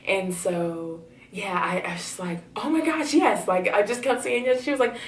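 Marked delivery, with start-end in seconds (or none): 2.6-3.5 much higher and louder, thrilled; squealing with joy; excited yes. Retold or imitated. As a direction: blurt it out overjoyed. Accepting the job offer.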